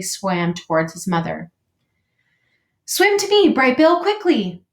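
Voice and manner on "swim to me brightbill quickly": high, loud cry across water; urgent call. Acted. Robot mother calls her son from shore, urgent high shout. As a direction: call out urgently to a child in danger.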